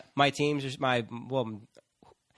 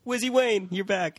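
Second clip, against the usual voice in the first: excited welcome; 7 semitones higher than usual. Acted. Welcoming sports host.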